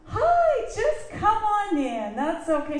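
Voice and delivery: high, loud, drawn-out mock welcome; over-bright hostess greeting. Flustered hostess letting in an early guest, over-bright high welcome. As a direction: gush a sing-song welcome at the door.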